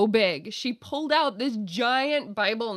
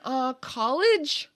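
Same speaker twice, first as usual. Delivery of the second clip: slow, hesitant "college?" rising like a guess; "Uh, college?" drawn out as if unsure of the answer; 6 semitones higher, much slower than usual. Retold or imitated. Answering the border agent's question, hesitant and unsure. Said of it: "And this would be my answer. I'd look away".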